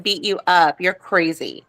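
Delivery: ordinary delivery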